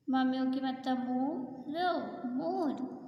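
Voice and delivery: ordinary talk, nothing marked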